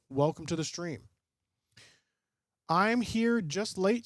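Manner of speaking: ordinary talk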